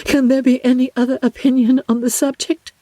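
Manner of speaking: a gentle, hesitant sister confiding; Jane's voice. Acted. Jane confiding her doubts to her sister, lightly voiced.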